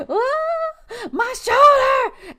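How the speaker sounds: high yelling cry of shoulder pain; mock pained shout. Imitating himself hurt in the corner, high pained shout. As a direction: yell out as if the shoulder popped. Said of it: "I would be in the corner like".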